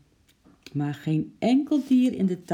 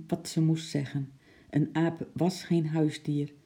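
usual speaking voice

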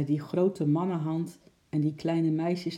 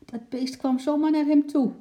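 much higher, loud amazed cry; amazed call; 12 semitones higher, 5 dB louder than usual. A narrator's reading. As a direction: marvel out loud at the animal. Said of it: "riep de slagersjongen".

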